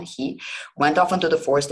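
usual speaking voice

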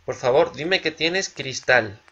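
normal talking voice

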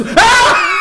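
very high, loud shriek; piercing jump-scare scream; startled scream. Real, not acted. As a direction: scream in sudden fright. Startled by monster in game.

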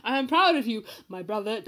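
normal talking voice

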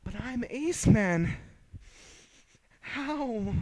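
high, slow, bewildered villain whine; whiny villain voice. Acted. Villain in disbelief.

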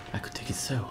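soft, whispered scheming aside; hushed, voiceless musing. Pretend. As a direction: whisper it as a secret plot. Plotting aside during play.

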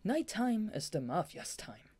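Dazai's voice. Acted. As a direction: savour the line, slowly and coolly. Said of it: "Daza said".

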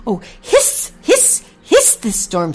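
angry goose. A narrator's reading. Mother goose curses the storm, angry hissing. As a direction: hiss in anger, drawing the words out. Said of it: "said Ginger angrily".

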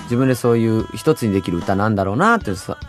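normal talking voice

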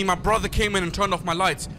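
usual speaking voice

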